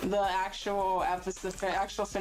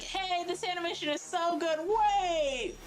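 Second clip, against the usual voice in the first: mock fan reaction. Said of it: "everyone goes like".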